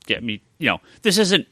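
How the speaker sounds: normal talking voice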